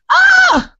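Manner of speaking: high-pitched "ah!" outburst; sudden yell. Real, not acted. Comic telling her club story, sudden high yell. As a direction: let out a high, loud cry.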